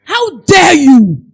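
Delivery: retold furious lash-out. Lashing out at someone speaking aggressively, furious yell. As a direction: blow up at someone who insulted you. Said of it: "I would just lash out".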